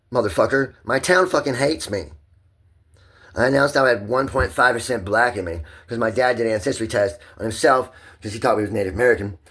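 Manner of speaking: high, loud shouting easing slightly; angry high-pitched venting; real heated rant. Real, not acted. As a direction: yell it out, worked up and aggrieved. Ranting about town and dad.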